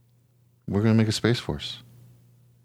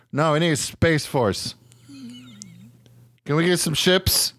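a higher, excited mock president, mostly unvoiced in his own tone; 5 semitones higher than usual. Podcaster imitating the president, giddy and eager. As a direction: play a giddy president who wants spaceships.

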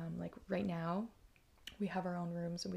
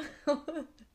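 laugh; 7 semitones higher, 3 dB louder than usual. Real, not acted. Laughing at her plan.

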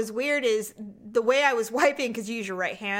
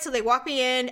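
usual speaking voice

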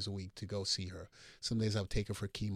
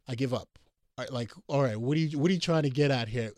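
exasperated give-up; 3 semitones higher, 6 dB louder than usual. Retold or imitated. Exasperated after third question.

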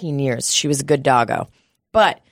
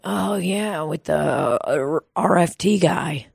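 put-on vague elderly man; 5 dB quieter, slower than usual. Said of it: "he's like".

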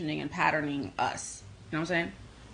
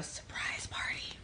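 hushed, voiceless aside; secretive whisper; 11 dB quieter, slower than usual. Real, not acted. Host telling viewers about a surprise party, whispered.

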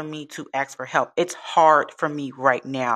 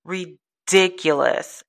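real frustration; 6 semitones higher than usual. Real, not acted. Job seeker venting about paying to apply, frustrated. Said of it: "the level of frustration in my voice".

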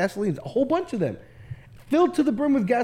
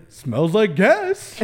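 slow satisfied sniff; much slower than usual. Retold or imitated. Kid self checking the can.

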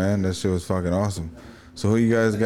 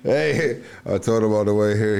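usual speaking voice

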